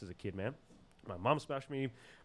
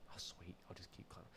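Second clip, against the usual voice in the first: very soft, lower, near-whisper; hushed self-talk; 4 semitones lower, 15 dB quieter than usual. Retold or imitated. Boy alone up the tree, hushed self-talk. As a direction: murmur it to yourself under your breath. Retelling his thoughts up the tree.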